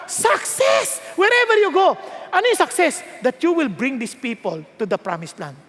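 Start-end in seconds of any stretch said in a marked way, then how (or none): 0.1-2.0 preaching shout. Acted. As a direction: shout Success high and hard. Preacher completes the verse, crowd call.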